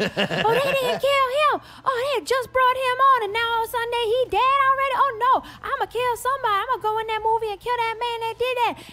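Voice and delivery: very high, loud imitation of an upset moviegoer; mock outraged high-pitched yelling; hyped-up woman yelling. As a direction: imitate her shrieking death threats, hammed up. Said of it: "She was very hyped up".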